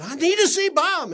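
high, loud insistent burst; fired up. Retold or imitated. Man pleading with jail clerk, fired up. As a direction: demand it loudly, all fired up. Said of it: "get all fired up and say".

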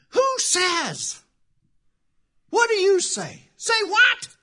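mock the scolding church folk at full voice; full-force preaching. Pastor lampoons judgmental Christians to the congregation, full-force preaching.

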